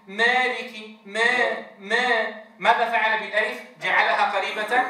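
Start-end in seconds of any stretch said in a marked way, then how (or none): 0.1-2.3 slow مالك with the alif bent toward ee; drawn-out imala on ما; alif leaning to ya. Shown as a demonstration. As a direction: stretch ما slowly with an ee colour. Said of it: "فلما يقرأ في القرآن بيقول".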